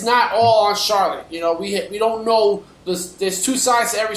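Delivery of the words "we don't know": ordinary delivery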